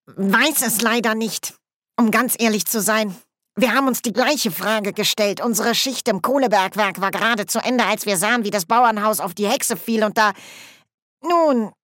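hesitant dwarf spokesman. Acted.

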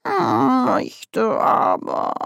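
slow, high, sulky mumble. A narrator's reading. Grebo sulkily answers Nanny, slow and high. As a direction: sulk like a stubborn tomcat. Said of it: "erwiderte Grebo verdrießlich".

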